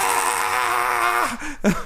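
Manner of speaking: high startled cry; big brother hearing his sister crash, yelping high; high shocked yelp. Retold or imitated.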